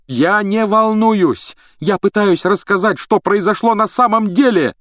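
high, loud, heated protest; fuming. A narrator's reading. Harry trying to tell what really happened, fuming. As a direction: burst out hotly. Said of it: "пылил Гарри".

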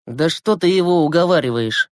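ordinary delivery

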